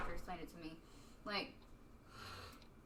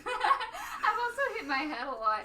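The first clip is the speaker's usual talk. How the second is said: loud, high laugh running into slow words; cracking up while talking; laughing hard; 11 semitones higher, 9 dB louder, slower than usual. Real, not acted. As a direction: confess it through real laughter. Laughing hard at own injury confession.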